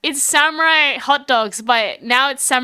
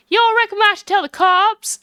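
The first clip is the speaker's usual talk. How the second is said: higher folksy Southern voice; eager country witness; 9 semitones higher, 4 dB louder than usual. Acted. Lotta asking whether to tell the cops, eager country voice. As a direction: pipe up as a country busybody.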